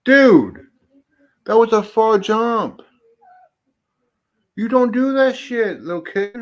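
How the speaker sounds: loud, high-pitched exclamation; startled scolding. Real, not acted. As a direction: call out in alarmed reproach. Scolding after startling jump.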